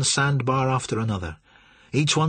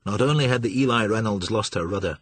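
ordinary delivery

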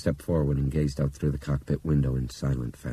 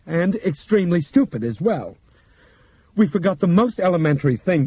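higher, measured, careful character voice; Bob's precise voice; 13 semitones higher, 7 dB louder than usual. Acted. Bob addressing passengers over intercom, measured explaining voice. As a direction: explain it like a calm lecturer.

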